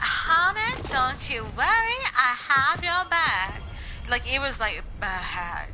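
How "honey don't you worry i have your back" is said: high, loud Southern drawl, stretched out slowly; exaggerated Southern drawl, louder and drawn out; thick Southern accent. Shown as a demonstration. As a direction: lay the old Southern twang on thick. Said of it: "I had this really, really bad slang".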